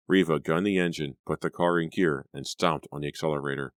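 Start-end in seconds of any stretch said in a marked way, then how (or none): none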